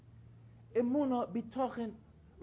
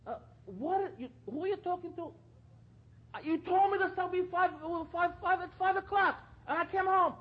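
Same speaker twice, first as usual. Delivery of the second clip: loud, high angry husband shouting at his wife; husband yelling over his wife's phone call; 10 semitones higher, 10 dB louder than usual. Retold or imitated. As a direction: bark it at her like a rude husband. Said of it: "how her husband screaming at her".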